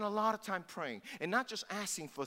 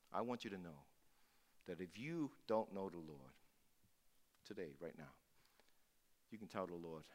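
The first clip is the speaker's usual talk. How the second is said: gentle altar call; 10 semitones lower, 7 dB quieter than usual. Acted. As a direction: draw them in quietly, gently. Closing altar call invitation.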